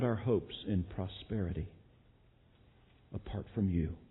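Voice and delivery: pastor closing the sermon in prayer. Acted.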